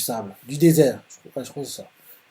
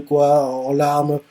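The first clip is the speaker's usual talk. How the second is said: normal talking voice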